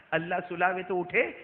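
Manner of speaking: ordinary talk, nothing marked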